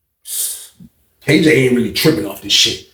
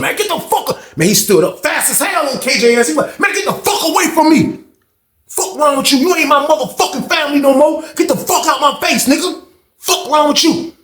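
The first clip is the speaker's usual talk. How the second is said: furious shout; 13 semitones higher than usual. Retold or imitated. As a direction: tear into him, get out of my face. Banks explodes at K J.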